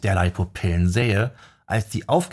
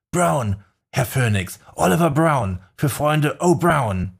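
eifriger Fan; 6 semitones higher than usual. Acted. Fan stellt sich vor.

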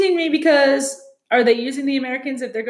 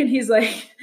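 ordinary delivery

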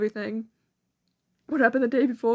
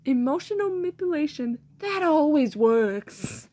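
ordinary talk, nothing marked

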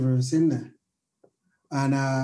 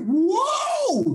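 a sudden high yelp of amazement; a retold whoa, shot up high; amazed whoa; 3 dB louder than usual. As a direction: gasp it out, way up high. Retelling their reaction.

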